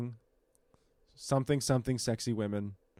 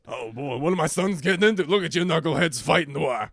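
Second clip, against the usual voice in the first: dad voice. Retold or imitated. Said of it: "He plays their dad".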